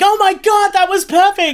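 high, loud, thrilled shriek of joy; overjoyed yelling at a perfect take; excited scream. Retold or imitated. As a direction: shriek it like the take just blew you away. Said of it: "I started screaming".

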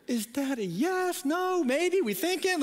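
retold nervous questions, climbing high; anxious prompting. Groom pressing crying girlfriend for an answer, mock anxious. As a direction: pitch rises as you fish for an answer.

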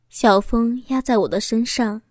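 plain reading voice

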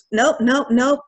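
insistent refusal. Retold or imitated. Student running to the bathroom to cry, insistent refusal.

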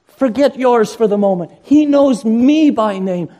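high, loud, fervent pulpit preaching; preaching at full force. Acted. Pastor urging his congregation to come to the shepherd, preaching at full force. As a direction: drive the call home to the whole congregation.

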